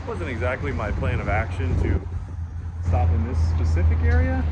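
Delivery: panting after a jog; winded, gasping between words; out of breath. Real, not acted. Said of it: "Sorry, I'm a little winded".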